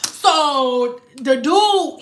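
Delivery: ordinary talk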